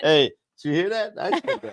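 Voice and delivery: high, laughing mock surprise; mock amazement. Speaker imitates himself marvelling at his own words, amazed. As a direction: act amazed at yourself, pitch leaping up.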